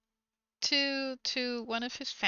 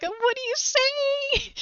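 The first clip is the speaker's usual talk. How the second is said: much higher, slow incredulous cry; outraged women; 12 semitones higher, slower than usual. Retold or imitated. Both women react to his excuse, incredulous. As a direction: stretch it out in disbelief. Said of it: "both her and the lady".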